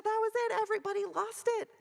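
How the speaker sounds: excited retelling. Retold or imitated. Retelling the crowd's laughter.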